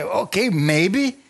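slow, high, hesitant verdict; mock priest stalling; doubtful priest. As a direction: drag out the doubt. Priests hesitantly inspecting leper.

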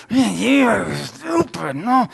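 high, slow, furious snap at himself; steaming mad at his own mistake; angry outburst. Retold or imitated. Mechanic cursing himself in anger, high and slow. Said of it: "I'm steaming".

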